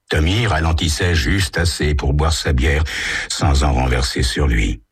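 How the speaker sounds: ordinary delivery, nothing marked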